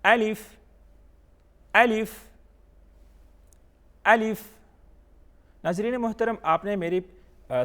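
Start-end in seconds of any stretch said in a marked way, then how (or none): 0.0-4.4 الف kept short, with a clear f; higher, short الف, paused between repeats; read quickly. Shown as a demonstration. Teacher drilling learners to repeat, short raised الف. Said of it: "جلدی پڑھیں گے".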